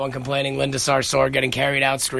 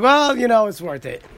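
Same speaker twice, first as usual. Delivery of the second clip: smug payday shrug; 8 semitones higher, 3 dB louder than usual. Pretend. Picturing his paycheck, a high smug well that fades off. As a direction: play it off like the overtime was nothing.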